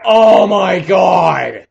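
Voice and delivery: real gamer rage; frustrated shout. Real, not acted. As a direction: bellow it slowly. Frustrated at being killed.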